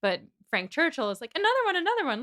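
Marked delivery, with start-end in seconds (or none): 1.3-2.2 high-pitched, excited mock pleading; eager egging-on. Frank urging Jane to keep singing, eagerly. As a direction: push for an encore, pitched way up.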